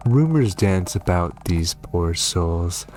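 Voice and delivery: usual speaking voice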